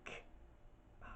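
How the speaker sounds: breathy whispered "K-" then "Ma"; sharp unvoiced syllables, spaced apart; Jason sound. Shown as a demonstration. Fan shows what the composer recorded, whispered syllables. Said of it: "the composer went".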